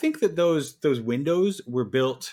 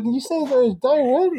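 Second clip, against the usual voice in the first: much higher, louder mock of the worker mishearing; baffled drive-through worker; 11 semitones higher, 5 dB louder than usual. Imitating the worker who gets the order wrong again, much higher and louder. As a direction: play the order taker as hopelessly confused. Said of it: "They're like".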